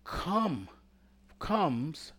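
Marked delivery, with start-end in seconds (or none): none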